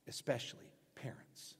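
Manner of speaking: whispered aside; preacher whispers to parents in the congregation. Real, not acted. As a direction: whisper it pointedly. Whispered emphasis.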